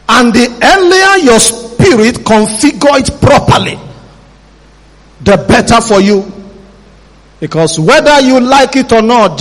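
high, slow, soaring preacher voice; preaching at full force. Acted. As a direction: build the sermon with fire.

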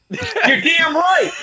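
high, drawn-out mock cheer; hammed-up shout of agreement, slow and soaring; hype man. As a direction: play the over-eager yes-man. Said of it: "that's a vice presidential hype man".

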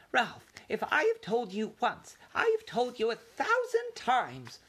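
high, fussing mother's scold; lightly voiced nagging mouse mother. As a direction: wind up a familiar scolding.

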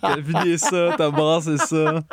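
talking through laughter; laughing. Real, not acted. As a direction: tease them while laughing. Teasing summary while laughing.

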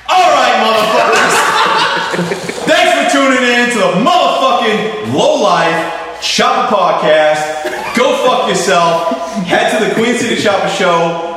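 loud, high hype-man shout; hammed-up hype host yelling; Loctite impression. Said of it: "I'll be Loctite".

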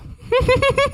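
high imitated giggle; Boyle giggling. Officer giggles after biting the marshmallow, imitated giggling. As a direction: mimic someone breaking into helpless high giggles. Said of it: "and he just starts giggling".

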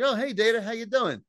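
high, breezy greeting; retold Riker. Riker arriving to greet Data, retold in a high breezy voice. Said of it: "Riker arrives. He's like".

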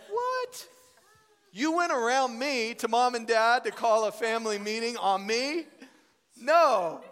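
loud, high-pitched indignation; mock outrage. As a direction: protest as if betrayed, hammed up. Acting out outrage at his siblings.

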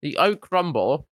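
talking through a biscuit; words round a mouthful; chewing while talking. Real, not acted. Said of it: "excuse my chewing".

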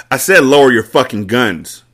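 loud, furious command; angry shout. A narrator's reading. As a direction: bark the order at the room. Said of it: "Carter shouted angrily".

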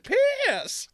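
high, loud triumphant yell; excited prospector shout. Pretend. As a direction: shout it like striking gold. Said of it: "equally as excited about the pee".

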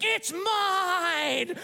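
high, drawn-out mock toddler screech; possessive four-year-old. Four-year-old claiming the playground house, high possessive screech. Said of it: "this four-year-old was like".

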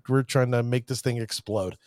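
ordinary delivery

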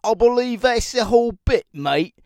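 loud, high mock Aussie twang; mock Australian. Mocking the Australian guy, loud and high. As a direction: throw it back in a cheeky Australian accent. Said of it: "Australian guy".

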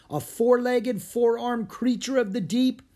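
high, loud, theatrical question; blustering mock astonishment; incredulous. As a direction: marvel aloud at an absurd find. Professor mocks the strange find.

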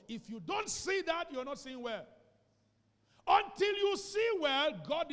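builds to a full, high preaching shout. Real, not acted. Pastor urging the church, rising to a shout.

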